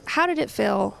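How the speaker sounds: ordinary talk, nothing marked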